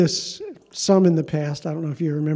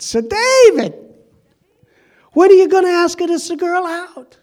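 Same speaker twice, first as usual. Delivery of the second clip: much higher, louder put-on Italian accent; Rosie's Italian accent; 13 semitones higher, 8 dB louder than usual. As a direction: ham up a pushy Italian lady teasing David. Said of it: "It's my imitation of Rosie".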